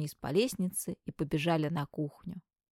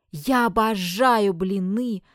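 loud, slow delighted declaration; joyful; 3 semitones higher, 7 dB louder, slower than usual. Acted. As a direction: announce it with delight. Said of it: "радостно заявил Клеон".

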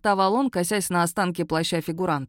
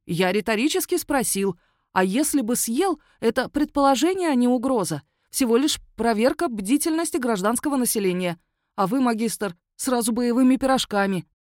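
wheedling werewolf; 5 semitones higher than usual. Acted. Werewolf to the magistrate, high defensive excuse. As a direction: talk your way out, pitched up.

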